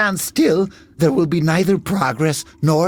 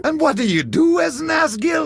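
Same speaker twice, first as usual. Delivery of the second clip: indignant demand; 9 semitones higher than usual. Acted. Shocked at collaborator news.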